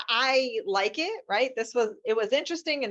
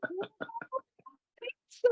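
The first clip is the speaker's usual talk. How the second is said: tiny, very high, soft squeak; mock sheepishness; 7 dB quieter than usual. Attorneys answering judge about a bonfire, squeaky mock sheepishness. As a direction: imitate a meek, evasive reply.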